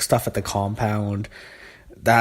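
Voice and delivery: usual speaking voice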